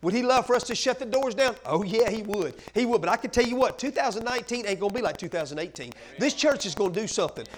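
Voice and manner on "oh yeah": usual speaking voice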